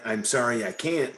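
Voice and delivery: usual speaking voice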